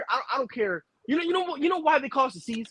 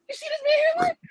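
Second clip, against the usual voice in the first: mock crying; faster than usual. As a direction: fake sob, very high and strained. Mocking hairline, crying sound.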